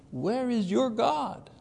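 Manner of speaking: high, slow mocking taunt on "your God". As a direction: draw out "Where is your God" as a jeer. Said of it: "allows his enemies to taunt him".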